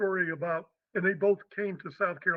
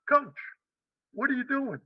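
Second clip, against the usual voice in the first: higher-pitched Southern coach; Southern accent; 6 semitones higher than usual. Retold or imitated. Head coach dropping in on the new coach, Southern accent. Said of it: "in a real Southern accent".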